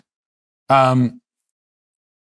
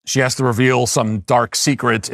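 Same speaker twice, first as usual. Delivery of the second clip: normal talking voice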